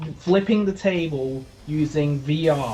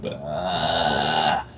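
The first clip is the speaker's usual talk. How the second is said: disgusted groan; 11 semitones lower than usual. Retold or imitated. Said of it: "and I was like".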